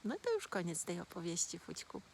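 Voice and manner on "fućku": plain reading voice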